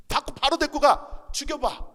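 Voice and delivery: taunting dare. Retold or imitated. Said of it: "그랬어요".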